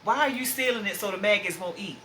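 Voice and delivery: normal talking voice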